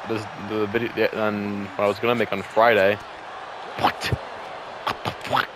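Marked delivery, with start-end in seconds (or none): 3.8-5.5 Donald Duck voice. Pretend. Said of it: "y'all like my Donald Duck impression".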